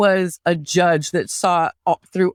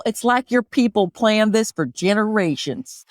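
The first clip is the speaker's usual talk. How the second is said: put-on Southern accent; 2 semitones higher than usual. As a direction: play a needling Southerner with a thick accent. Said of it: "can you do an Appalachian accent".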